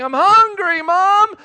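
high, loud mock child yell; hammed-up child's wail; [name]. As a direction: holler for mom like a hungry kid. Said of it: "she's screaming, and [name]'s going".

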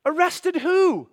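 loud, high, drawn-out question; shocked disbelief. Acted. Believer hearing of an arrest from the neighbor, loudly shocked. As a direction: stretch it out in alarm.

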